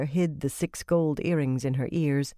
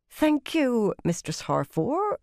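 tight, sarcastic thanks through teeth; through gritted teeth; 8 semitones higher, slower than usual. A narrator's reading. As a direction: bite the words back, barely civil. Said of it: "said through her teeth".

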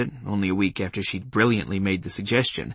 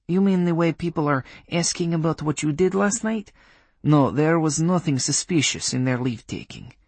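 put-on Polish accent; 6 semitones higher than usual. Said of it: "in a Polish accent".

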